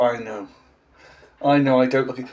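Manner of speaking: normal talking voice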